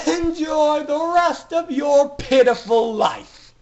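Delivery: high, loud, slow cackling taunt; gloating villain. Acted. Villain jeers at ponies as door slams, gloating. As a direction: sneer it out like a triumphant villain.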